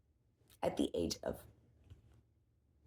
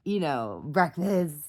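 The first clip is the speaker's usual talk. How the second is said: usual speaking voice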